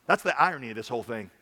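normal talking voice

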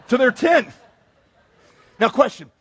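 usual speaking voice

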